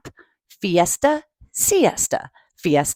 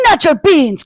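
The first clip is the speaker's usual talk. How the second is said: louder, higher indignant outburst; mock outrage; outraged cry; 7 semitones higher, 5 dB louder than usual. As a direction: protest like your beans are at stake. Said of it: "cried Scipito, outraged".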